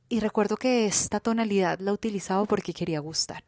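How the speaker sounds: usual speaking voice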